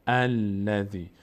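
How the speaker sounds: «الذی» begun on a clear "al", slow; starting with open a. Shown as a demonstration. Teacher modelling the word as a verse opening, «الذی» slow with initial "al". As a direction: say «الذی» slowly as "al-ladhi".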